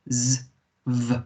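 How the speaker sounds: isolated "z", pause, "v"; "z, v" as phonics sounds; separate sounds. Shown as a demonstration. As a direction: buzz "z" and "v" one at a time. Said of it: "the W in German is a v".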